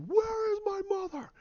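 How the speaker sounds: very high, strained cry; anguished scream. Retold or imitated. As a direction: act out a desperate scream. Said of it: "You're like".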